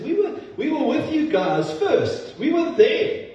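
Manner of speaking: very high-pitched acted insistence; playful high-voiced protest; Paul's eager protest. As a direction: pitch it way up, imitating an eager claim. Acting out Paul's eager claim.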